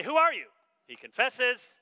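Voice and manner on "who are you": high, loud demand; questioning demand. Retold or imitated. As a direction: fire the question at him. Questioners interrogate John.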